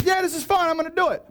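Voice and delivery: high-pitched, breezy gung-ho burst; acting out an overconfident volunteer, high and quick; eager confidence. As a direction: jump in with hammed-up eagerness. Acts Peter's eager confidence.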